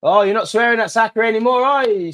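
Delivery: high, loud gloating jeer; gloating taunt. Real, not acted. Fan gloats at Everton fans who swore at Saka, high and loud.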